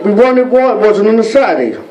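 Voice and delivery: usual speaking voice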